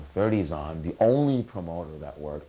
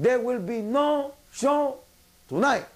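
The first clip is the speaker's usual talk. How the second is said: high, slow put-on announcement; drawn-out mock proclamation, word by word; Federico Pagani; slower than usual. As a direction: imitate a promoter solemnly addressing the house, high-pitched. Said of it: "Federico comes out".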